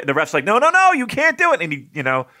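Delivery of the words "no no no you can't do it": high, loud mock referee objection; protesting referee. Imitating the ref stopping a wrestler, protesting. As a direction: cut in loudly, pitch way up. Voices referee forbidding the move.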